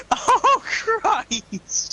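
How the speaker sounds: high, loud laughing outcry; laughing exclamation. Real, not acted. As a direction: burst out laughing in disbelief. Laughing at the LP moment.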